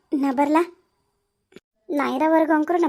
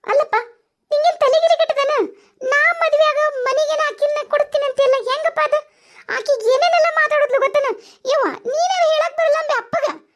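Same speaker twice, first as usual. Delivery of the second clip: much higher, indignant whine; 1 whiny complaint; 10 semitones higher than usual. Acted. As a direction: complain to dad in a high fretful voice. Daughter complaining to father.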